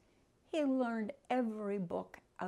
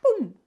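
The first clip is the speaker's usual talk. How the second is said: loud boom; 8 semitones higher, 14 dB louder than usual. A narrator's reading. Ghost vanishes.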